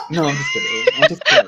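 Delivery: very high-pitched reassurance; playful backpedal. Acted. Ghost concierge taking back a threat, high and playful. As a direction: squeal that it was only a joke.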